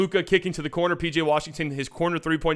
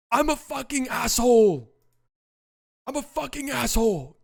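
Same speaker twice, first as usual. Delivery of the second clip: Luka Doncic self-taunt; 9 semitones higher than usual. Retold or imitated. What he hopes Luka said, high mock yell. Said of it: "what I really hope he's saying".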